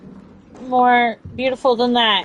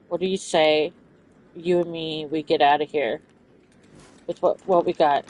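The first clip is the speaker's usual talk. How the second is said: soft cooing; 12 semitones lower, 9 dB quieter than usual. Acted. Soft voice to game character.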